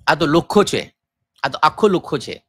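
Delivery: casual Gujarati-speaking tone; Gujarati phrase. Shown as a demonstration. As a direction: drop into Gujarati like a local. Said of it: "ہمارے وہاں لکھا جو زبان ہے".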